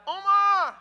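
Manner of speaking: high, held-out call from afar; mother calling. Retold or imitated. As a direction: call a child through the house. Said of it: "She'd be like".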